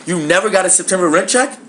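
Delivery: high, rising incredulous question; stunned disbelief. Retold or imitated. Tenant answering landlord about unpaid rent, stunned. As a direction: let the pitch shoot up in acted shock. Retold shock at landlord's news.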